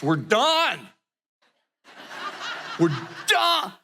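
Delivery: mock fed-up outburst, pitch leaping up; exasperated declaration. Husband answering the mediator about tension, exasperated. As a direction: act out a spouse who has had it. Couple's tense outburst retold.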